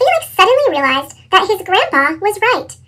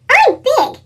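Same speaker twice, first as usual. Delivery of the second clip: put-on high little-girl voice; little sister voice; 9 semitones higher than usual. A narrator's reading. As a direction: play the small sister insisting.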